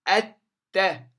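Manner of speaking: "الت-" loud, as a sharp at-ta; doubled ta. Shown as a demonstration. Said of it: "وتظهر التاء مشددة". (نطق "الت-" بتاء مشددة)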